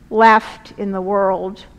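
normal talking voice

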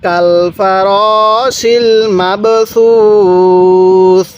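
very slow, drawn-out Quran reading; teacher reciting slowly for students to repeat; slow recitation. Shown as a demonstration. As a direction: recite each syllable slowly for students to copy. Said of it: "পড়ুন".

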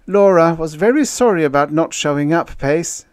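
high, loud, earnest voice; apologetic officer. A narrator's reading. Officer passing on the girl's apology, earnest. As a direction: explain earnestly, pitched well up.

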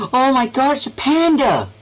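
high loud excited squeal; delighted gasp. Real, not acted. Spots a panda in game.

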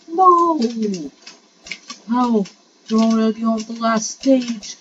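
high, loud wail of no, then incredulous; disbelieving outcry. Real, not acted. Player sees rival already on last stage, disbelieving wail.

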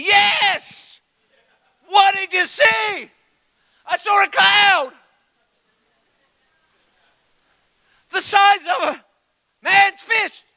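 high, loud excited shouts; excited servant shouting. Retold or imitated. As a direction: call back breathless with news from the hill. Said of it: "he goes there and he's like".

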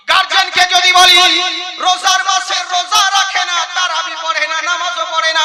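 high, loud ringing outrage; indignant shout. Acted. Preacher listing the boy's sins, loud and high. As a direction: hammer each failing home. Denouncing a son who skips prayer.

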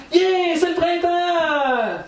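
high, slow, stretched cheer; excited yell. Retold or imitated. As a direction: belt out the joy of spring. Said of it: "ils crient".

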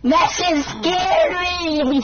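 scared cry. Real, not acted. Scared reaction in horror game.

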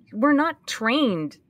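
usual speaking voice

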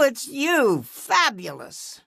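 ordinary delivery

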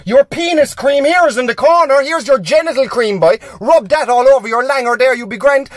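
loud, high, indiscreet announcement; shouting. Acted. Pharmacist announcing a customer's private business, shouted. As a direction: shout it so the whole shop hears. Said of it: "but shout the wrong thing".

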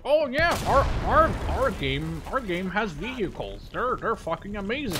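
high, hammed-up mock game ad; mock a developer bragging about vehicles; mock hype. Gamer mocking developers who brag about vehicles, hammed-up hype voice. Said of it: "every game is like".

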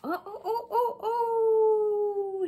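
high rising oh oh; panicked cries. Acted. Child carried off by balloon, crying out in alarm. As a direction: cry out as you float away.

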